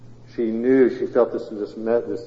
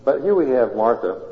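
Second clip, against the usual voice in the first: normal talking voice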